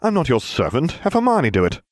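high, sneering refusal. A narrator's reading. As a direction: refuse with disdain, pitch rising. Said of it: "I sneered at him".

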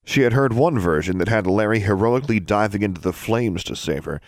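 ordinary delivery

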